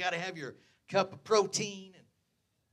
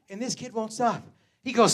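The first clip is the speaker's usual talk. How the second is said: usual speaking voice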